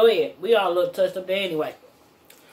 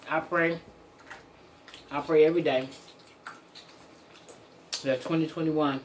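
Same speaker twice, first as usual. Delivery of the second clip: talking while chewing; muffled chewing speech; mouth full; 4 semitones lower, 5 dB quieter than usual. Real, not acted. Seen 4: Fork with food at mouth, bite taken, talks chewing.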